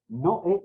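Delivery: Noé in two syllables, no-É, stressed é; broken diphthong. Shown as a demonstration. Teacher correcting the reading, Noé split as no-É. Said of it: "Se rompe el, ese, ese dictongo".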